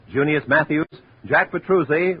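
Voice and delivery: ordinary talk, nothing marked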